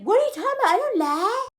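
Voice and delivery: high-pitched, affronted protest; mock indignant denial. Man denying he lies to her, mock indignation. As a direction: imitate a liar acting offended, voice jumping up.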